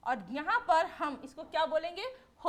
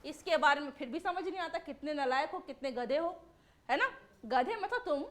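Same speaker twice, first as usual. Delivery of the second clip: ordinary talk, nothing marked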